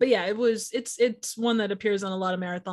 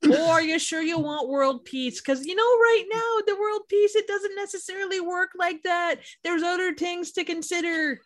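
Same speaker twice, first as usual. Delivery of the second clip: put-on Wisconsin accent; 7 semitones higher than usual. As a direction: warn them like a chatty Midwestern genie. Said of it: "Wisconsin accent".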